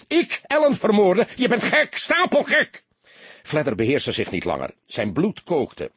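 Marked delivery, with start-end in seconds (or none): 0.1-2.8 much higher, loud enraged roar. A narrator's reading. Soldier roaring at the detective who accuses him. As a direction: bellow the accusation of lying. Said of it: "brulde hij".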